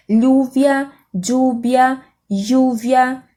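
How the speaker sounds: lluvia said first with a plain l, then with a dj sound, then with a zh sound; three regional ways. Shown as a demonstration. Teacher showing how "lluvia" varies from country to country. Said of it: "diferença nesse fonema, dependendo do país".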